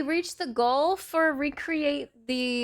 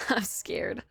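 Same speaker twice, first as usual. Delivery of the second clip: whispered through a giggle; laughing whisper; 3 semitones lower, 6 dB quieter than usual. Real, not acted. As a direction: giggle it under your breath.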